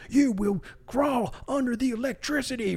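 high, loud mock drill-sergeant bark; mock drill yell. Imitating the fake Marine who yelled at him at the electric obstacle, high loud mock yell. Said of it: "He was just yelling at me".

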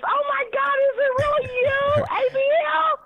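gasping with delight, voice cracking high; overjoyed shriek. Real, not acted. Caller recognizing her favorite host, excited high squeal.

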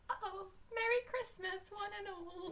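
high, small, soft voice; mock forlorn. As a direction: wish it feebly, as if heartbroken. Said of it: "And you're like".

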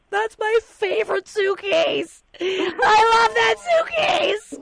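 put-on high, slow, wailing child's cry; imitating a crying child. Mother imitating her daughter wailing over a suitcase, high crying shout. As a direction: drag the words out like a sobbing little kid. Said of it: "And Lou's hysterical crying".

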